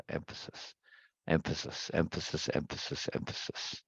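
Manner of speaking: near-whispered, fading murmur of "Emphasis"; whispered repetition. Real, not acted. Teacher musing the key word to himself, whispered. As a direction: mull the word over under your breath.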